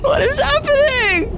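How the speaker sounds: 느리게 울부짖듯 당황하며; slow panicked wail. Real, not acted. Stuck in oven, panicking.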